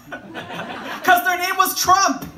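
high, loud, drawn-out yell; exasperated punchline shout, way up in pitch. Acted. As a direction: belt out the obvious answer slowly.

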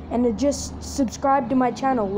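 usual speaking voice

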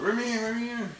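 high squeaky dog voice; voicing the dog. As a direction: speak for the puppy begging to come in.

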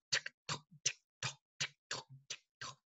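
soft whispered ticking; whispered tick-tock. Shown as a demonstration. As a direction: tick softly like a clock, whispering. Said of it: "Can you sound like a clock?".

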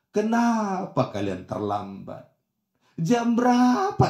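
slow, stretched-out scolding questions; mock interrogation. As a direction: drag out each question, rising on the second. Mimics a parent's interrogation. (pura-pura menginterogasi, diulur-ulur)

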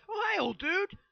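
high, loud, cheerful hello; excited greeting. Real, not acted. Greeting a game character.

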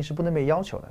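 usual speaking voice

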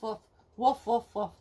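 usual speaking voice

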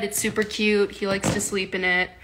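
ordinary talk

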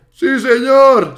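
loud, high, drawn-out cheer; exultant gamer celebration; triumphant cheer. Real, not acted. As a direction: stretch out the victory shout. Celebrates a successful jump.

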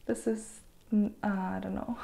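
unvoiced, unsure whisper. Real, not acted. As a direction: trail off in a hush. Unsure about new bangs.

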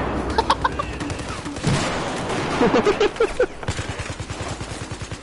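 airy giggle, high pitched; high breathy laugh. Real, not acted. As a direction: giggle helplessly. Laughing after his remark.

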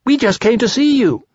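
high small creature voice; high little storybook piglet; eager Piglet. A narrator's reading. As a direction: pipe it up high. Narrator voices Piglet visiting.

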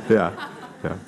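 usual speaking voice

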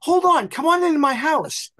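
put-on high, loud, eager little old lady. Old lady urging the visitor inside, high, loud and eager. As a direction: call him in like a thrilled old neighbor. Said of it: "He, and".

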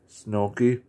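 usual speaking voice

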